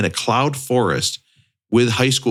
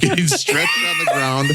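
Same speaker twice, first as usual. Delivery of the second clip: high laughing shout; cracking up at the memory. Real, not acted. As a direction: laugh it out, mortified. Laughing at own photo.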